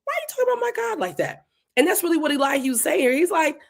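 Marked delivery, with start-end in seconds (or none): 0.0-1.5 quick, high-pitched mock indignation; upset protest. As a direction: blurt it out, offended on God's behalf. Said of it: "'cause I get upset. I'm like".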